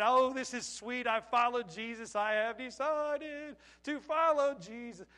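loud, drawn-out, hammed-up bliss sliding into hymn; blissfully naive. New worker delighted with following Jesus, blissfully naive. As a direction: stretch it out sweetly, then croon the hymn.

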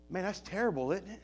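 soft and low, shaking his head in the voice; quiet dismay. Real, not acted. Preacher to congregation about gossip, quiet and low. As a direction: murmur it with quiet disapproval.

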